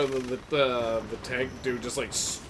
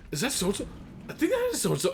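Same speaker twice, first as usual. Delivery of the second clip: excited; 10 semitones higher than usual. Retold or imitated. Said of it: "you're like".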